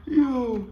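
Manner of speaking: high loud burst of laughter; shocked laughter. Real, not acted. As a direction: crack up in disbelief. Reacting to funny animal video.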